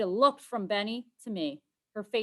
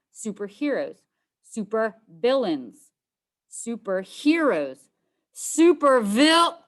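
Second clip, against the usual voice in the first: escalating bickering; 4 semitones higher, 11 dB louder, slower than usual. Acted. Escalating name-calling back and forth.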